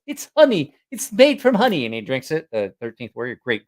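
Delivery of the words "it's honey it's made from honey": high, loud, insistent film quote; movie character impression. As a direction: exclaim it like an eager film character. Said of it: "He goes".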